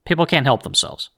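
ordinary talk

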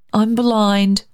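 put-on child admitting it; boy's confession. A narrator's reading. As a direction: own up as a small boy. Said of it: "David owned".

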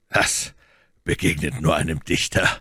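understated serious remark; mildly grave comment; earnest. A narrator's reading. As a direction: say it soberly, with no lift in the voice. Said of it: "versetzte Athos ernst".

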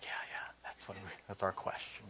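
hushed whisper of agreement; unvoiced whispered yeah yeah. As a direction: whisper like students relieved someone asked. Other kids agree quietly.

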